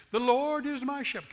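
high, whiny mock recital. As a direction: whine it like a self-pitying complainer. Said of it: "kind of in a whiny tone".

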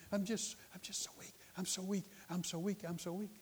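mock feeble whimper; feeble sick woman. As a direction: imitate a frail patient repeating herself softly. Acted weak sick woman, pleading.

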